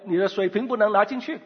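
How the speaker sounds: normal talking voice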